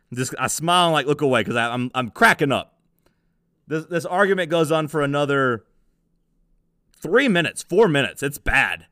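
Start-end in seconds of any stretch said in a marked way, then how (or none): none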